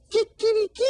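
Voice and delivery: very high, loud crow; loud rooster kikiriki. Shown as a demonstration.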